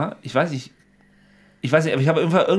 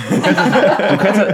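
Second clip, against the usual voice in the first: laughing tease; 7 semitones higher, 5 dB louder, much slower than usual. Real, not acted. Teasing friend about Essen.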